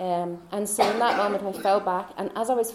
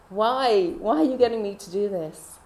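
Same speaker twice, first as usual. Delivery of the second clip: mock protest; 8 dB louder than usual.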